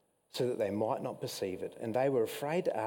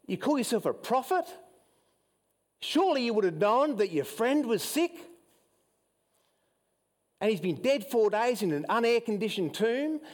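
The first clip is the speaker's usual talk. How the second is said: pitched higher, mocking and incredulous; scornful jeer; 8 semitones higher, 4 dB louder than usual. Detractors taunting Jesus at the tomb, higher and scornful. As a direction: sneer at him like a scoffing detractor. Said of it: "they're saying".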